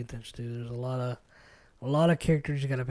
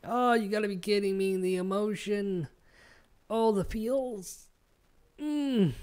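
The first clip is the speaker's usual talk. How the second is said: mock anguish; 5 semitones higher than usual. Retelling being overcome by the film.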